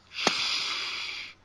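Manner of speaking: long hushing shh; shushing. Retold or imitated. Co-host re-enacting shushing their giggling girlfriend, long hiss. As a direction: hush someone with a drawn-out shh. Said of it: "I have to tell her".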